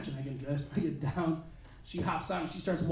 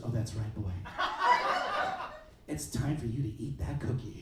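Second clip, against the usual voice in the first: low seductive woman; 8 semitones lower, 8 dB quieter than usual. Retold or imitated. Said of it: "she looks down and she goes".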